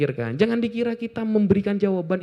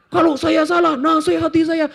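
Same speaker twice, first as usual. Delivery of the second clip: mock angry demand; 8 semitones higher than usual. Imitating a critic who angrily demands advice. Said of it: "Marah tapi".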